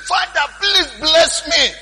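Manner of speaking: high, drawn-out mock wail; acted high-pitched tearful begging; imitated crying plea. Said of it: "he cried".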